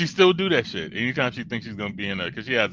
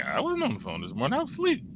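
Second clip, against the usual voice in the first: mom's innocent denial; 7 semitones higher, 3 dB quieter, faster than usual. Retold or imitated.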